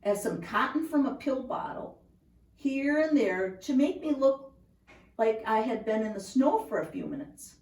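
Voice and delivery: usual speaking voice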